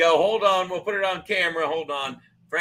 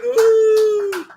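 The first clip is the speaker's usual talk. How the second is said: long, high, loud "woo"; cheering whoop; 12 semitones higher, 8 dB louder than usual. Real, not acted. Host signing off the show, long celebratory whoop.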